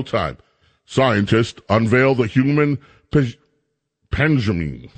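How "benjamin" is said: normal talking voice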